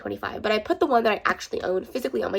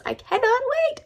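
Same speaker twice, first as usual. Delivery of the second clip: excited; slower than usual. Real, not acted. Squeals about excitement for book.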